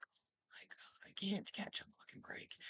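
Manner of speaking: breathless whispered exasperation. Retold or imitated. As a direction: mutter it under your breath.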